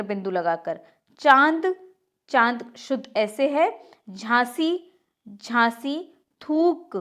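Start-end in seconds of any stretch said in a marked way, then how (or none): 6.4-7.0 थूक with a nasal vowel, thoonk; wrong nasalised pronunciation. Shown as a demonstration. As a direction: say थूक through the nose, as thoonk. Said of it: "थूक नहीं होता".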